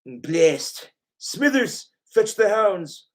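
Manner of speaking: scheming cartoon tycoon, rising into command; Simpsons boss. Boss sends Smithers for the hounds, villainous command. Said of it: "My Simpsons voices".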